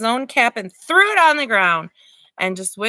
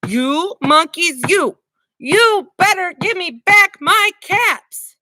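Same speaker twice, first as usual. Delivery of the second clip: higher, slower angry demand; quite angry; 9 semitones higher, 5 dB louder, slower than usual. Acted. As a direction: stomp and demand the caps. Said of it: "Now he felt quite angry".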